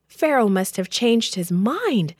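plain reading voice

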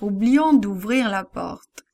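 plain reading voice

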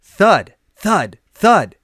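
loud, heavy thud on each word, like a fist on a door; loud knocking thuds. A narrator's reading. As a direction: pound the words out like knocking.

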